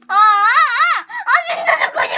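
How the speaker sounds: loud, high cry of embarrassment; mortified wail. Real, not acted. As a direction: wail as if dying of shame. Mortified by own footage.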